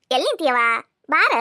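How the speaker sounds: ordinary talk, nothing marked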